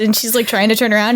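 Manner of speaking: ordinary talk, nothing marked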